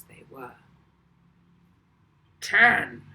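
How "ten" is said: loud booming voice over a speaker; booming countdown. Acted. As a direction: boom it out like mission control. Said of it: "the controller's voice boomed out".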